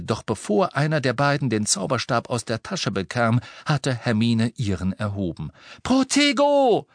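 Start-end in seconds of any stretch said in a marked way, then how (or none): 5.8-6.9 high, loud, drawn-out cry; shouted spell. A narrator's reading. Hermine casting a shield between the boys, shouted spell. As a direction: cast it with a sudden cry. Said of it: "rief sie".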